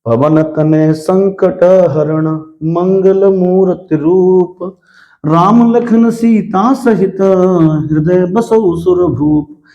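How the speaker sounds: very slow, drawn-out recitation; teacher demonstrates slow verse reading; slow motion reading. Shown as a demonstration. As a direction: stretch every syllable of the verse. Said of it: "slow motion में पढ़ूंगा".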